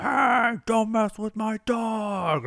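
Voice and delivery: very high, strained mock yell, drawn out; imitated angry yelling. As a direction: yell it like the irate dog handler. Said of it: "he starts yelling at us".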